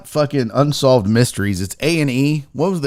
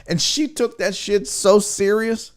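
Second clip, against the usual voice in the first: normal talking voice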